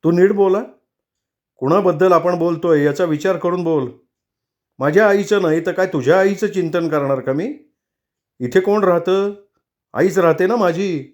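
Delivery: husband furiously scolding his wife. Acted. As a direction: scold her hotly, defending his mother. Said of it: "त्याला अतिशय संताप आला".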